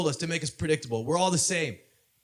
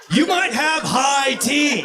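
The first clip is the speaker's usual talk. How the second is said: slow, louder and higher, stretched out; bigger shouted punchline; 4 semitones higher, 4 dB louder, much slower than usual. Acted. Comic to audience, repeating the punchline, slow and loud. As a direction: hit the punchline hard and slow.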